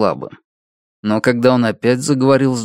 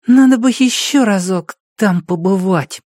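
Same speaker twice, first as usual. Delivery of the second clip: put-on high child's voice, pensive; little boy musing; thoughtful child; 8 semitones higher than usual. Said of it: "прибавил задумчиво".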